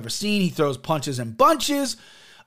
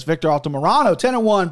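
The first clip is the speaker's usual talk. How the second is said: ordinary talk, nothing marked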